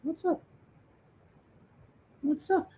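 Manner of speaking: put-on high, sing-song baby talk; baby talk to cat. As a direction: coo at the kitty in a high voice. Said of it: "And I'm like".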